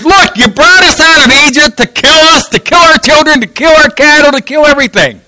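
high, insistent protest to Moses; grumbling complaint. Acted. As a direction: pile up the accusations at a high pitch. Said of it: "grumbled against Moses and said".

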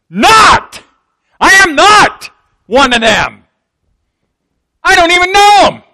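high, loud, indignant denial; emphatic denial. Acted. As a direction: shout it out, flatly refusing to be linked. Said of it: "it was kinda like this".

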